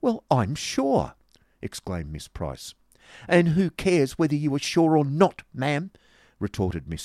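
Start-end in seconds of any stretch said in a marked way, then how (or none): 0.0-1.2 high, slow, loud huff; haughty, high-pitched exclamation; affronted. Acted. Acted quarrel, Miss Price offended. 3.3-5.9 loud, high-pitched snapping back; indignant, quarrelsome comeback; scornful retort. Acted. Acted quarrel, snapping back at rival.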